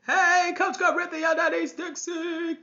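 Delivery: high-pitched, drawn-out hello to a friend; excited greeting. Real, not acted. Coach spots a fellow coach joining live, excited greeting. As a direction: call out gleefully as your colleague turns up.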